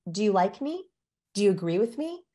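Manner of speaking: questions lifting at the end; permission-seeking uptalk. Shown as a demonstration. Eager-to-please person seeking approval, pleading uptalk. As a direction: ask as if begging for approval, rising endings. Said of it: "it's a permission-seeking behavior".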